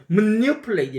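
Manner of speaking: manipulate said slow and loud, stress on the second syllable, as 머뉴플레디; emphatic native manipulate. Shown as a demonstration. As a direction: lean hard on the NI of manipulate. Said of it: "상당히 다르".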